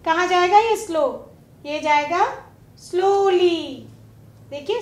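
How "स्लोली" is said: स्लोली very slowly, both syllables stretched. Shown as a demonstration. Teacher showing where the suffix goes, स्लोली slowly syllable by syllable. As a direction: draw स्लोली out as "slow", then "lee". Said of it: "two syllable है".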